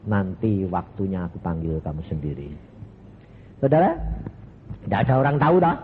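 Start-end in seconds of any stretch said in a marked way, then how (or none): 0.1-2.5 lower, softer reassurance; gentle voice of Jesus. Retold or imitated. Jesus gently promising to call him home himself, lower and softer. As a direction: reassure him quietly as the Lord.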